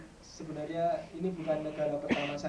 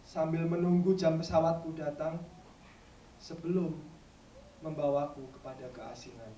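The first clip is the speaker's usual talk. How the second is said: measured verse with pauses, sinking at phrase ends; poetry recitation; 3 dB louder than usual. Acted. Recites third poem.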